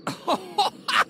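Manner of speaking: high-pitched gloating cackle; evil laugh. Acted. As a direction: laugh wickedly, high and drawn out. Villain gloats over the victim's sorrow.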